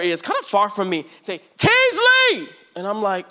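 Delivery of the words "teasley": high, loud call across a room; calling out a name. Retold or imitated. Lady calling his name across the student union, high and loud. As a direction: hail him from far off by the door.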